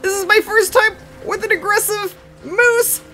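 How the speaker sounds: high, breathless excited rush; thrilled and rattled by the charge; really excited, voice way up; freaking out. Real, not acted. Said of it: "I'm freaking out!".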